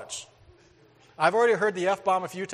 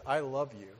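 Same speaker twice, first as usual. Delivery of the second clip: sincere; 3 semitones lower, 6 dB quieter than usual. Retold or imitated. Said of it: "I said it with sincerity".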